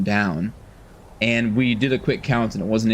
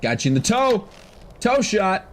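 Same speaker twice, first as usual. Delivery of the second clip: excited; 11 semitones higher than usual. Real, not acted. Lands arrow on troll.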